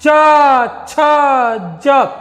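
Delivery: isolated च, छ, ज said slowly; sounding out letters. Shown as a demonstration. Teacher sounding letters for pupils. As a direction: say च, छ, ज one by one. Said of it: "च को हम बोलते हैं".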